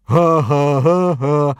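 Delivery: hammed-up jeering laugh; mocking laughter. A narrator's reading. Boys joining in the bully's taunt, laughing mockingly. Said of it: "laughed all the other boys".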